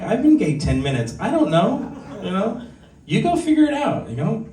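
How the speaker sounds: high squeaky laughing protest, pitch climbing up; mock exasperated. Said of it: "I'm like".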